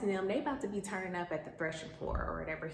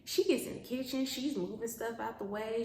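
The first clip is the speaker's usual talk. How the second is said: ordinary talk, nothing marked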